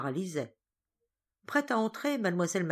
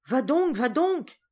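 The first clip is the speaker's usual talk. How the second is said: loud, slow, higher push; commanding order; 8 semitones higher, 8 dB louder, slower than usual. Acted. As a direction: order her up the ladder. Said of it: "ordonna mademoiselle Solange".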